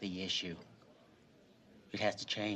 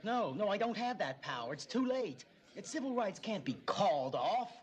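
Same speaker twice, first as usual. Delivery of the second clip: loud, much higher angry shout; 12 semitones higher, 3 dB louder than usual. Acted. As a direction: yell it in frustration. Heated argument with father.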